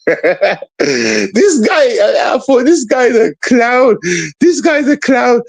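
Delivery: high-pitched, laughing mockery; scoffing, drawn out with laughter; laughing ridicule. Laughs at man he calls clown.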